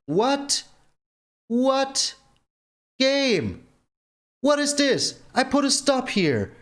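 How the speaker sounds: high, stunned what?; can't believe what the game just did; real outrage at the game; disbelief. Real, not acted. Stop card failed in game.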